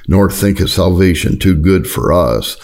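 normal talking voice

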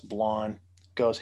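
ordinary talk, nothing marked

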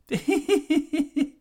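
high, light giggle; high giggling demo. Shown as a demonstration. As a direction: giggle like a schoolgirl. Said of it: "Then there's the giggle".

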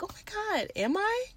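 tiny, high, hushed voice; flattered disbelief. Retold or imitated. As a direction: squeak it softly, acting bashfully flattered. Retells flattered reaction to compliment.

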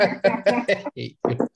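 laughing through the words; half-laughing, high and slow. Real, not acted. Friend jokes he's racist.

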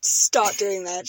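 usual speaking voice

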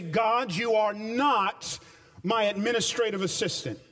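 slow, lifted preaching prayer, each line weighed; earnest pulpit prayer, drawn out; surrender prayer. Acted. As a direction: pray it out as giving up control.

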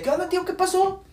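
very high-pitched phone hello; high, questioning quoted greeting; acted phone greeting. Brother's phone reply, acted again.

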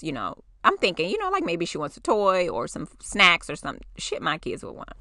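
ordinary talk, nothing marked

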